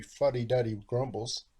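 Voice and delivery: ordinary delivery, nothing marked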